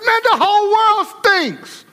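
high, fed-up whine at everything; exasperated complaint. Retold or imitated. Preacher voicing a man who blames the world, high whine. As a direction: act out a man blaming the whole world.

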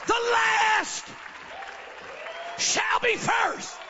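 loud, high, slow shout; booming proclamation. Real, not acted. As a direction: proclaim it, stretched out. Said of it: "High five somebody and say".

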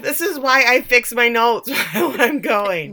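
real laugh breaking into the words. Real, not acted. Podcaster laughs at own garbled notes, laughing as they speak.

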